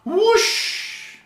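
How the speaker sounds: very high, loud whoosh. Pretend. Psychic mimicking a leaf blower scattering cash, loud whoosh.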